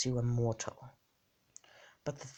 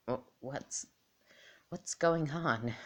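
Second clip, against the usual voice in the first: mildly bewildered, rising higher and louder; confused Deku; 3 semitones higher, 3 dB louder than usual. Acted. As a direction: ask it slowly, puzzled by the strange place. Voices Deku waking confused before doll.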